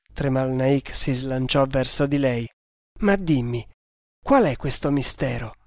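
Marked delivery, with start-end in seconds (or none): none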